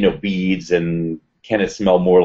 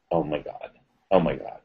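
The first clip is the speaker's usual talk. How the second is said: low, softened disbelief; under your breath, stunned; stunned hush; 5 semitones lower, 7 dB quieter than usual. Retold or imitated. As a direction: murmur it in quiet shock. Retelling her stunned reaction.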